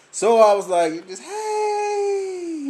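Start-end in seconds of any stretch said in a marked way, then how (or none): 1.2-2.7 shouted hey. Retold or imitated. Calling back to the coworkers, high shout. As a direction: shout a quick hello across the street.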